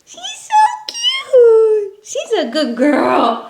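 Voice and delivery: very high, slow cooing. Real, not acted. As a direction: coo over the puppy. Cooing over dog.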